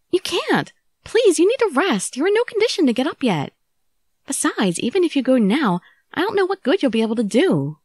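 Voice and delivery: high, urgent pleading. Acted. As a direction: beg him to stay in bed. Pleading with sick Okita to rest.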